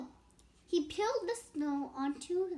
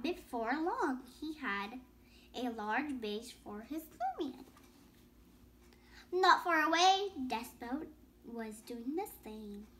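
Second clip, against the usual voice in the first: story reading; 2 semitones higher than usual. A narrator's reading. Child reading a picture book to listeners, in story-reading voice.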